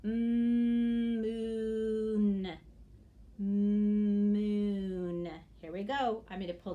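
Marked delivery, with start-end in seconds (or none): 0.0-2.6 "Mm, moon" stretched out, louder; said slowly. Shown as a demonstration. Teacher shows children slow sounding-out, "Mm, moon" drawn out. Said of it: "Now say it slowly". 3.4-5.3 "Moon" stretched out, louder; said slowly. Shown as a demonstration. Teacher repeats it for children, "Moon" drawn out. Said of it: "Now say it slowly".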